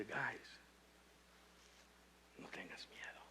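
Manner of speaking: soft, low whisper of comfort; whispered calm to scared friends; whispered reassurance. Acted. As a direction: hush them gently as Jesus. Jesus speaks to frightened disciples.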